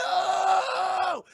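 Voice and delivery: long, high, hammed-up yell; drawn-out mock cry of pain; mock agonized scream. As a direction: scream it like a horror victim. Acting out victim as bone breaks.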